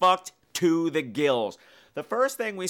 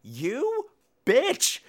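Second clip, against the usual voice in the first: mock outraged; 9 semitones higher than usual. Real, not acted. Narrator reacting to Ellie stealing the boyfriend, mock outrage. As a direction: fling it out in delighted shock. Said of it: "reacts to scene".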